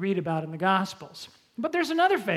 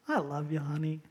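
hollow tender; 6 semitones lower, 9 dB quieter than usual. Retold or imitated. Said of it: "you tell your spouse".

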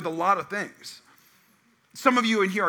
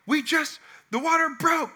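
urgent news; 9 semitones higher than usual. Retold or imitated. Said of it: "she's like".

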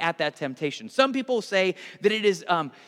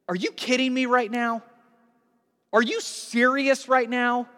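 incredulous rebuke; 6 semitones higher than usual. Acted. Peter grabs Jesus to rebuke him, incredulous rebuke. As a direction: demand an answer, appalled. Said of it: "that word rebuke".

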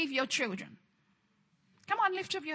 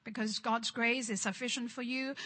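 twisted, resigned version; 4 semitones lower, 7 dB quieter than usual. Retold or imitated. Preacher mimics how the religious spirit twists the verse, low and soft. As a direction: lower and softer, said as an excuse to suffer. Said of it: "the religious spirit twisted it".

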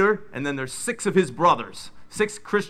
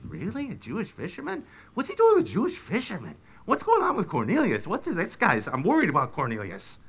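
higher, hushed, scandalised guest; shocked gossiping guest; 3 semitones higher than usual. Acted. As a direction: gasp it out like a worried relative. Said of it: "they're probably all whispering".

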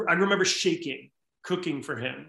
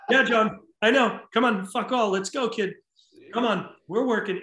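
urgent call; 6 semitones higher, 4 dB louder than usual. Retold or imitated. Chef urging on kitchen staff.

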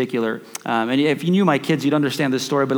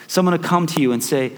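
ordinary delivery

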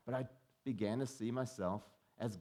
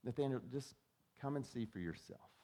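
gentle invitation; 7 dB quieter than usual. Retold or imitated. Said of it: "he's like".

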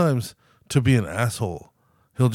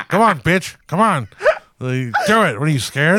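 lightly raised, loud goading dare; mock taunt; 5 semitones higher, 4 dB louder than usual. Roommate taunting from the couch, raised goading dare. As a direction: goad him on like a cocky roommate.

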